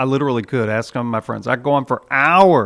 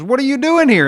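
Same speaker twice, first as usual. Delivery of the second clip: much higher, louder squeak of a question; high supervisor angel; 13 semitones higher, 6 dB louder than usual. Retold or imitated. Supervising angel demanding why the thief is at the gate. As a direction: pitch it way up like a fussy official.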